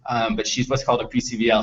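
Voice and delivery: ordinary delivery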